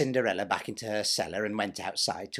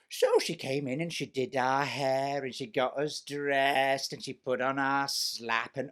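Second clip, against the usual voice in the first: ordinary delivery, nothing marked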